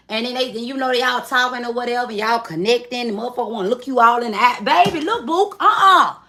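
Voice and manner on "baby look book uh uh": mock flirty protest. Partner cuddling, mock flirty protest.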